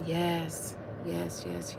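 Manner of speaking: ordinary talk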